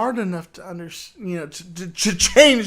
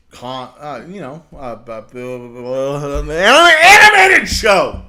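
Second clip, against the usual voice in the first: mock stammer; 10 dB louder than usual. Mocking a host fumbling for words, stammer into a shout. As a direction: trip over it, then blurt it out loud. Mocking host's stumbling retelling.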